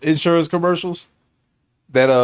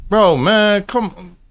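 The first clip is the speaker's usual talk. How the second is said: ordinary talk, nothing marked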